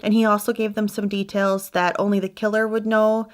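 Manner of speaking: usual speaking voice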